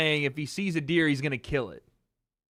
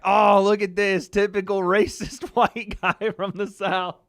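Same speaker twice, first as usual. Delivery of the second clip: slow, louder jeer with a laugh; mocking commenters; 4 semitones higher, 5 dB louder than usual. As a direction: drag it out as mocking online commenters. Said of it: "they were, like, laughing. Being like".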